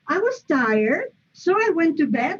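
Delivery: both halves rising, was and I lifted high; wrong rising tune. Shown as a demonstration. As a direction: say it like a question that never lands.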